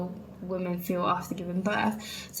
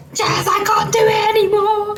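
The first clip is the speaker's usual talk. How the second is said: high, loud strained voice; a squeaky cry of surrender from her body; body pleading; 14 semitones higher, 12 dB louder than usual. Retold or imitated. Her body begging Jess to give in, high and loud. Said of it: "my body was like".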